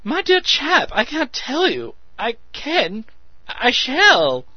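excited. A narrator's reading. Excited Meadows voiced by narrator.

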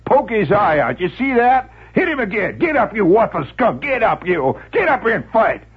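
high, excited crowd shouts; shouting spectator. Acted. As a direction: egg on the fight, shouting. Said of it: "More shouts".

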